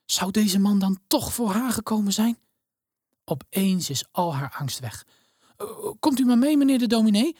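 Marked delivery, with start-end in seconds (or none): 5.6-7.3 put-on high, quick and eager, a girl hurrying to please; hurried girl. A narrator's reading. Elena hastily invites the minister, high and eager. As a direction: rush it out like an excited little girl. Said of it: "zegt ze gehaast".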